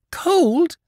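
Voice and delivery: shocked high gasp of a word; the word caught in horror. Acted. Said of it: "gasped the mother".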